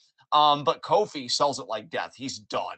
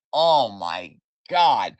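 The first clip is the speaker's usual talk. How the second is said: awed; 4 semitones lower, 8 dB louder, much slower than usual. Real, not acted. Awed by the street fight.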